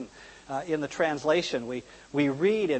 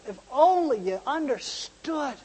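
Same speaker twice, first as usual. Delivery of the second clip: imitating bawling lament; 6 semitones higher than usual. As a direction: cry out in grief, acted without real sobs. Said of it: "he's bawling like a baby".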